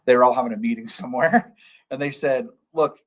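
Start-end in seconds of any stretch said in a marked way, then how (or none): none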